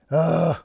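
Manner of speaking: short, loud exasperated ugh; real exasperated grunt; frustrated groan. Real, not acted. Frustrated at the dog paw.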